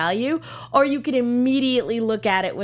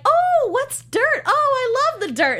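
gushing Ruby impression; 13 semitones higher, 4 dB louder than usual. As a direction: coo it like a sugary fake sweetheart. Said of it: "especially when she's like".

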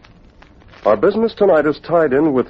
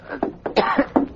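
gas cough; 12 semitones higher, 6 dB quieter than usual. Acted. Gassed by Hornet.

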